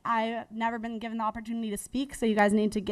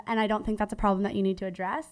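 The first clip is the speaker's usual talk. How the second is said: ordinary delivery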